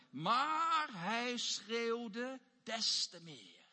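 slow, loud, emphatic Bible quote; emphatic reading. A narrator's reading. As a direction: stretch the verse out with force. Preacher reads the verse.

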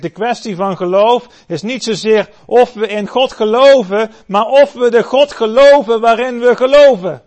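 high, loud fervent preaching; preaching at full force. Acted. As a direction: proclaim it to the whole church. Preacher drives home the point.

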